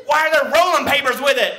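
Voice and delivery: high, loud suspicious question; lightly voiced officer demanding answers; suspicious cop. Patrolman questions papers.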